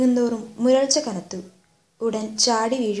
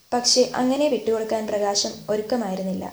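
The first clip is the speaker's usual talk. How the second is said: plain reading voice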